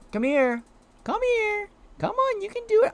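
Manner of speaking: put-on very high baby-talk coaxing. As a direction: coax a little pet in a high, cutesy voice. Coaxing a game creature.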